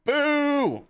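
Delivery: long, high, loud boo; imitated crowd booing. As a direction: boo like a whole rowdy bar. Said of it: "the bar boos her".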